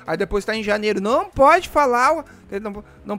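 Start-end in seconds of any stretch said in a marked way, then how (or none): 1.0-2.2 mocking voice. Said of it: "Não aguento aqueles caras que já começam".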